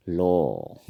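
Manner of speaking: "लो" with a long rounded o; Assamese pronunciation. Shown as a demonstration. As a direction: hold the o of "लो" for learners. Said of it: "उच्चारण होगा लो".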